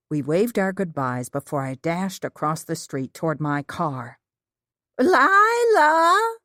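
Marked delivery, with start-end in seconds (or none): 5.0-6.4 loud, high call from down the road. A narrator's reading. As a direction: shout a name across the street. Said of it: "someone called".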